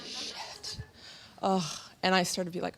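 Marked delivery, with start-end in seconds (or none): 0.1-0.8 whispered curse. Retold or imitated. Retold dismay at no galloping.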